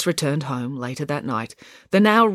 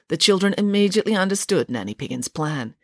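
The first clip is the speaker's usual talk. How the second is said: ordinary delivery, nothing marked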